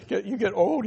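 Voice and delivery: normal talking voice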